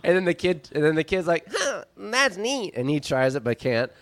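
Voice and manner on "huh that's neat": put-on little kid. Kid watching dog shake itself off, childlike. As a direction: marvel like a small child. Kid admires the flung syringes.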